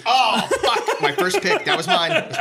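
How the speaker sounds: high, laughing disbelief; surprised laughing outburst. Real, not acted. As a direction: yelp it out, half laughing. Pick stolen by partner.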